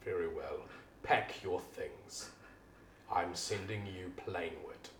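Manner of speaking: low, slow quiet sentence; cold boss. Acted. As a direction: pronounce the punishment coldly. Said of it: "as he says".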